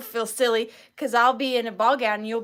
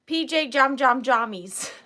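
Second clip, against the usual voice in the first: playful relish; 6 dB louder than usual. Real, not acted. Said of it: "That's pretty fun to say".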